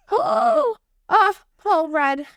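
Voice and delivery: gasping, high and thrilled; excited gasp. Real, not acted. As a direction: squeal with delight. Thrilled at getting Paul Rudd.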